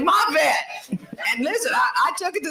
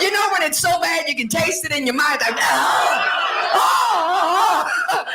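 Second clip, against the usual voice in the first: mock gagging; 5 semitones higher than usual. Describing unbearable dog fart smell.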